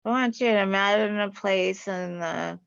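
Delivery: ordinary talk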